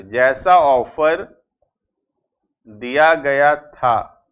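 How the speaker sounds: slow, word-by-word dictation with long pauses; dictating each word with a pause. Shown as a demonstration. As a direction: spell it out slowly so students can write. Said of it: "ध्यान से".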